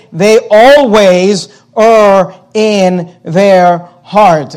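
"always" drawn out and stressed, very slow; slow stressed paraphrase. Shown as a demonstration. Pastor paraphrasing the verse for the church, slow stress on "always". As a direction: spell out "always" slowly so it lands. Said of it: "we would say".

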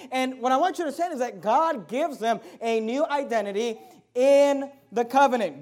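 usual speaking voice

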